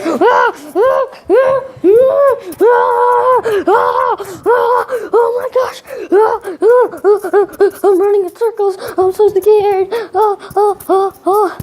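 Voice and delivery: high-pitched panicked cries, played up; mock-terrified high squeals while running; scared yelping. Kid running from Angry Puppy in circles, hammed-up panic.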